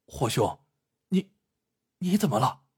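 halting, alarmed question; urgent, worried. A narrator's reading. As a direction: stammer out your concern for an ailing brother. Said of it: "嘉亲王急忙地问道". (急忙关切地追问)

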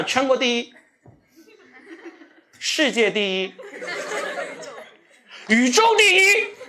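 high, slow, exaggerated flattery; hammed-up praise. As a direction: lay the flattery on thick. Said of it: "我当时就想". (夸张地拉高声调奉承)

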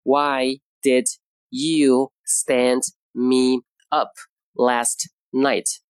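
very slow, each word drawn out for learners; slow model sentence. Shown as a demonstration. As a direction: spell it out slowly like a teacher. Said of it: "Today's expression is".

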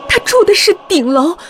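high, loud, tense storytelling; alarmed horror narration. A narrator's reading. Horror reveal of face at window.

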